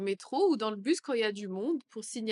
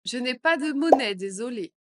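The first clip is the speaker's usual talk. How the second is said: louder apology; louder take; 3 semitones higher, 8 dB louder than usual. Shown as a demonstration. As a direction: say it loud and clear. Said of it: "phrase modelled twice". (plus fort)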